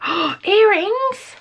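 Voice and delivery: high, slow delighted squeal of earrings; gasp, then stretch it out in delight; excited squeal. Real, not acted. Unboxing fan squeals over earrings, stretched out high.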